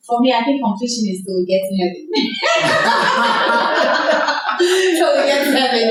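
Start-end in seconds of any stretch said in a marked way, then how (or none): 2.1-4.5 a real bright burst of laughter; hearty real laugh. Real, not acted. Laughing at own joke.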